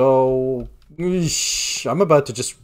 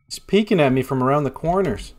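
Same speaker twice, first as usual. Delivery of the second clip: normal talking voice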